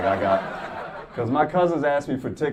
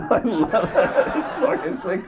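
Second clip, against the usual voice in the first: slow, laughing words; laughing hard while speaking; 11 semitones higher, 6 dB louder, much slower than usual. Real, not acted. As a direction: stretch it out through laughter. Laughing at own bit.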